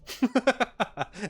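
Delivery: high, light chuckle; quick high-pitched giggle; short laugh. Real, not acted. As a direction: laugh briefly at your own remark. Laughs after own remark.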